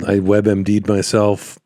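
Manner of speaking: ordinary delivery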